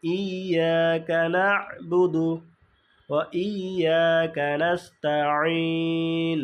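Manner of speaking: ইয়াকা না'বুদু slowly, stopping on the আইন; correct slow recitation. Shown as a demonstration. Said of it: "আমাদের পড়তে হবে এইভাবে".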